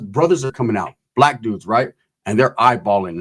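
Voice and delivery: ordinary delivery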